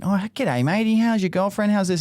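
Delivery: put-on high, chirpy old-bloke greeting; cheery old bloke. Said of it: "he went from being".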